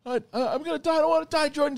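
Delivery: high, loud whiny panic; mock terror. Friend on the sinking boat, high panicked whine. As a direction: squeal like you're sure you'll drown. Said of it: "He's like".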